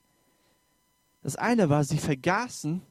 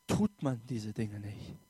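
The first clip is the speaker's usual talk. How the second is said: low emphatic close; 7 semitones lower, 7 dB quieter than usual. Real, not acted. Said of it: "preacher repeats the point".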